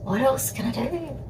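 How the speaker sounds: soft, high, helpless plea; helpless partner. Retold or imitated. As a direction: imitate a partner at a loss, very softly.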